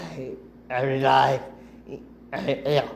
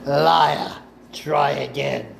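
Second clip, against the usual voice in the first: stern master snapping liar; cold accusation; slower than usual. Acted. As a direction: call out the lie with contempt. Dooku catching her lie.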